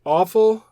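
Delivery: Offal said high and loud, set apart; stress on Offal. Shown as a demonstration. Host introducing a new word, Offal said high and loud. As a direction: land hard on the word Offal. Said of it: "O F F A L".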